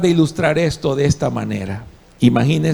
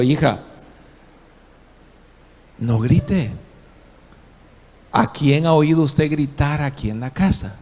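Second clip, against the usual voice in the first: gentle, lower mock-soft parental voice; soft words; 3 semitones lower, 3 dB quieter than usual. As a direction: coax the child quietly. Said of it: "con palabras suaves". (voz suave de padre)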